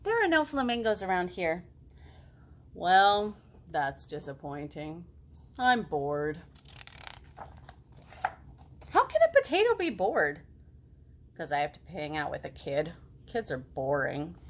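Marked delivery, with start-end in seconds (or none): 2.7-14.4 low, dull and sulky; bored potato. Acted. Potato grumbling to the girl, low and sulky. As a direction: sulk it out. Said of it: "potato complains".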